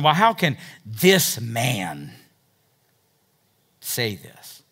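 langsamer, leicht abschätzig: how can this man; abfällig gedehnt, als zweifelnder Schriftgelehrter; derogatory scoff. Retold or imitated. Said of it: "you can picture him going".

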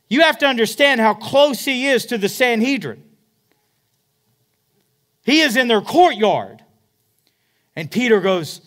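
high, loud fiery preaching; impassioned pulpit build with high loud peaks; preaching at full force. Acted. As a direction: drive it home like a revival preacher.

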